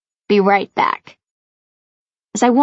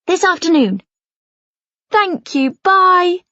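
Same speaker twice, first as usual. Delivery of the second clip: excited; 8 semitones higher than usual. A narrator's reading. Mum on phone, excited, grateful.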